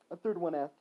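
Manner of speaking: ordinary delivery, nothing marked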